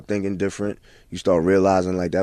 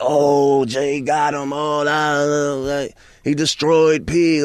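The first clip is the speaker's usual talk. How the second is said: high, drawn-out hype of an onlooker; mock excited crowd; 7 semitones higher, 4 dB louder, much slower than usual. Mimicking the average person in the crowd, mock excited. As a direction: imitate a fan making a big deal of it.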